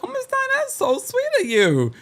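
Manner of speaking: very high, mock-sweet thanks; gushing Kelly imitation. Kelly thanking Miss Diane for the dress, mock gushing. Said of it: "And she's like".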